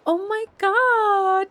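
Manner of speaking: ordinary delivery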